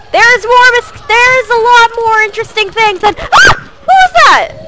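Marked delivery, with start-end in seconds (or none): 3.2-3.7 sudden high yelp of surprise; sharp startled cry. Real, not acted. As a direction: yelp in shock. Startled by something in game.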